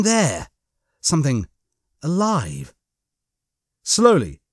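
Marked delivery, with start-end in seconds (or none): none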